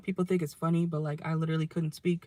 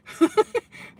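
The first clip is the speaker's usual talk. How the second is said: giggling burst; 8 dB louder than usual. Real, not acted. Laughs at own slip.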